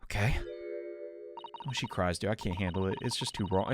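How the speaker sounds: ordinary talk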